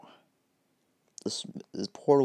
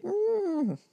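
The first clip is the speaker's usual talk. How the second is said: put-on baby dragon squeak. Baby dragon reacts to nuggie.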